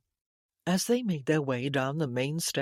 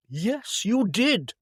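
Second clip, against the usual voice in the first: insistent contradiction; 5 semitones higher, 5 dB louder, slower than usual. A narrator's reading. Brother contradicts his sister.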